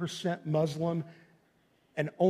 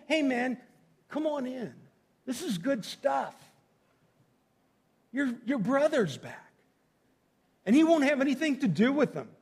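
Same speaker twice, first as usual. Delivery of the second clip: high, pleading dad voice; pleading father; 7 semitones higher than usual. Retold or imitated. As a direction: coax him in gently. Father pleading with older son.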